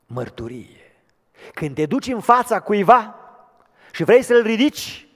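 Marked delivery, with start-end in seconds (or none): 1.5-5.1 much louder and higher, full-throated preaching; fiery preaching. Acted. Preacher urging the church to lift others, fiery preaching. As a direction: rouse the congregation.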